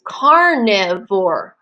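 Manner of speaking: "carnivore" slowly in three distinct beats; deliberate, sounded-out "carnivore"; separated syllables. Shown as a demonstration. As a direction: part each syllable of "carnivore". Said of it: "Did you hear the syllables?".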